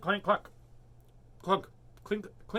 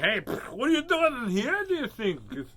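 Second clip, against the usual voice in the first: high, loud indignant cat voice; hammed-up feline grievance, pitched up; complaining cat; 6 semitones higher, 6 dB louder than usual. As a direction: voice a put-out cat griping at its owner. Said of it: "He's like".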